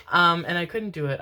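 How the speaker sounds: ordinary delivery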